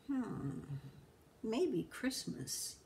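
soft, slow musing; thinking aloud. A narrator's reading. Grinch ponders Christmas, soft slow musing. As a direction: wonder it quietly to yourself. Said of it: "he thought".

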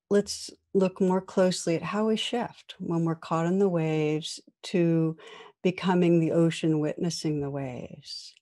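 usual speaking voice

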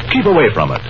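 ordinary talk, nothing marked

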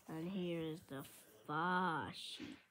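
distant voice; far away. Shown as a demonstration. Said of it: "I did that because it says near and far".

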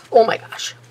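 Oh, high and loud, rising over my gosh; loud exclamation. Real, not acted. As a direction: burst out with real exasperation. Dismay at lost form, then found.

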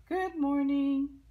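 high, loud, drawn-out sing-out greeting; friendly. Acted. Storyteller voicing the friendly ladybug's greeting, friendly. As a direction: sing it out slowly and high, cheerily. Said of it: "The friendly ladybug saying".

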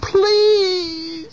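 high, drawn-out squeaky plea; little mouse begging; pleading mouse. A narrator's reading. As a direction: beg in a tiny high mouse voice.

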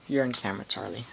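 ordinary talk, nothing marked

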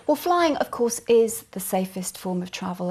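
ordinary talk

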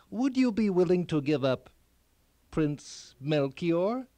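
slow, drawn-out question. A narrator's reading. Elf leaning forward asking the king for his son, slowly. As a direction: elf leaning in, each word slow and weighty. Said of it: "and spoke slowly".

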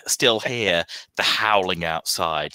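usual speaking voice

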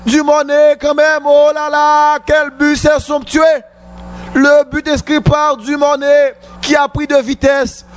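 high, shouted goal call; excited sports commentary. Real, not acted. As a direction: erupt with joy at the goal. Goal scored, commentator erupts.

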